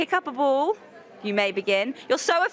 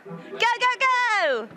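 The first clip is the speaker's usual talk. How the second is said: calling go; 11 semitones higher, slower than usual. Real, not acted. Starting the game, calling out.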